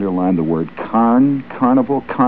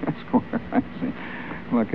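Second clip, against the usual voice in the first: usual speaking voice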